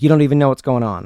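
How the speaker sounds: loud, fast, hammed-up angry comeback; a retold aggressive brush-off, rushed and loud; agitated retort. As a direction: snap it back at him in mock anger. Agitated guy retorting in quarrel.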